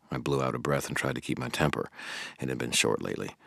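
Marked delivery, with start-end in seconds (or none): none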